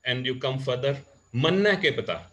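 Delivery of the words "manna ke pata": loud, high-pitched Haryanvi; Haryanvi dialect. Shown as a demonstration. Teacher showing the next region, in louder Haryanvi dialect. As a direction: punch it out in proper Haryanvi. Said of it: "this is proper Haryanvi".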